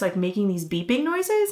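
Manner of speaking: ordinary talk, nothing marked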